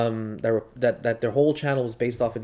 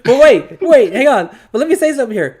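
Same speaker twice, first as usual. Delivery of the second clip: louder and higher, grabbing the floor; loud interruption; 11 semitones higher, 12 dB louder than usual. Real, not acted. Jumping in over the laughter to make a point, loud. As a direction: cut in loudly and take over.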